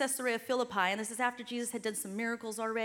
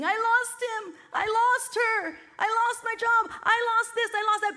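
higher, louder mock whining; higher, louder lost-everything lament; mock self-pity; 9 semitones higher, 5 dB louder than usual. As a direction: mimic someone stuck on their losses. Mocking a loss mentality.

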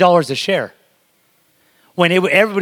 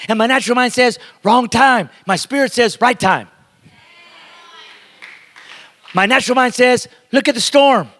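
raised, urgent preacher cadence; preaching at full force; 6 semitones higher than usual. Real, not acted. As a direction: hammer each contrast home.

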